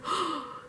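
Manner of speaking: short mock gasp of shock. Passenger meeting the driver's eyes, shocked gasp. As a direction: suck in a breath, aghast.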